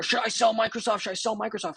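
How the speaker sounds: nervous uncle pleading for advice; uncle's worried question. Retold or imitated. Retelling how the uncle phoned him, anxious high voice. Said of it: "he's calling me last year".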